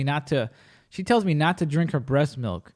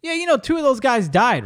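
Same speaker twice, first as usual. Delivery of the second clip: high-pitched, assertive imitation of her; know-it-all claim; 9 semitones higher, 4 dB louder than usual. Woman correcting a fan about his band, assertive. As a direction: pitch it up, sure of yourself.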